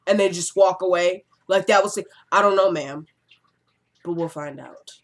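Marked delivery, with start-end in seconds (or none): 2.3-4.9 understated mock cop cool; smooth detective. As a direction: do a hint of TV detective, tailing off low. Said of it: "how the detective would do".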